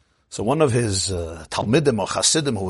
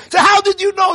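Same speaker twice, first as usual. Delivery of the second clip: astonished; 8 dB louder than usual. A narrator's reading. Astonished question to the rebbe.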